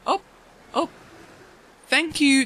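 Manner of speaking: ordinary talk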